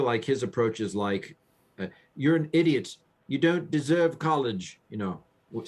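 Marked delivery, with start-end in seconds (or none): 2.2-4.8 higher-pitched, haughty British voice; snooty British accent. Retold or imitated. Mimicking the author scorning unready students, in a snooty British accent. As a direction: sneer down in mock snooty British. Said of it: "said in a snooty British accent".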